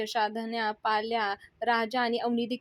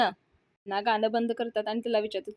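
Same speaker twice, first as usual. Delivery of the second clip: plain reading voice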